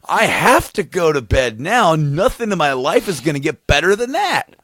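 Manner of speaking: high, loud, swooping proclamation; hammed-up awestruck insistence; dramatic stoned declaration. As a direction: declare it like life has just peaked. Stoned, dramatic answer to partner.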